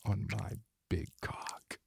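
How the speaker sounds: breathy unvoiced whisper. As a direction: lean in and whisper it. Intimate erotic roleplay whisper.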